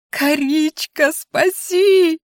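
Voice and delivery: high, drawn-out cry for rescue; desperate wail. A narrator's reading. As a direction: stretch «коричка» into a pleading squeal. Squashed critter wails for rescue.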